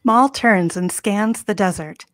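usual speaking voice

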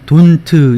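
"don't" as two beats, "don-tɯ"; wrong full t. Shown as a demonstration. Teacher shows what isn't said in sentences, "don't" as "돈트". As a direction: add a Korean-style "트" to the end of "don't". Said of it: "이렇게 발음이 안 되고".

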